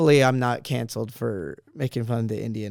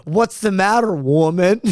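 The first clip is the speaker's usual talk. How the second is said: loud, higher-pitched mock retort; mock indignation; 6 semitones higher, 7 dB louder than usual. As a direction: jokingly challenge your girlfriend, raised voice. Mock retort to girlfriend in retelling.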